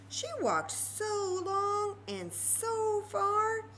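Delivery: slow, lilting storytime read; storybook narration. A narrator's reading. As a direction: stretch the words for young listeners. Reading story to children.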